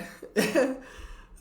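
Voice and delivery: high breathy burst of laughter; a sudden high laugh at herself. Real, not acted. Vlogger laughing to herself at the camera. Laughing alone outdoors, emotional.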